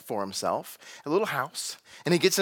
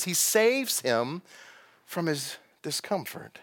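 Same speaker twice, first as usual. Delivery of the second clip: "saves" stretched out very slowly; very slow stress on saves; 4 dB quieter, slower than usual. Shown as a demonstration. Preacher pointing out the narrator's ironic word. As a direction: linger on "saves Jonah". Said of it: "uses this word salvation".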